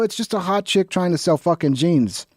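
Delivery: usual speaking voice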